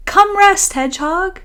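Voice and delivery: high, loud happy call; yelling. Acted. Friends in bed yelling goodnight to Hedgehog, high and loud.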